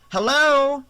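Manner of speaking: loud, high questioning call. A narrator's reading. Girl peers out into dark yard, calling out. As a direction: call out to whoever may be there.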